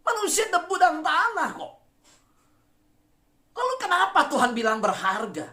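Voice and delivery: high, ringing preacher's cry; impassioned preaching. Acted. Preaches at full force.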